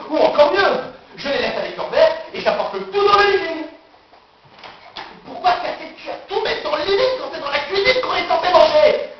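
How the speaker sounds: frantic high-pitched planning; agitated self-debate. Acted. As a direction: argue with yourself, pitch climbing.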